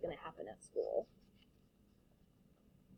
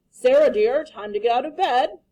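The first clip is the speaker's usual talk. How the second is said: loud wake-up call, higher; calling upstairs; 3 semitones higher, 11 dB louder than usual. Acted. Father waking his daughter, loud cheerful call. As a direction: call her out of bed brightly. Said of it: "Mister Hartwell said".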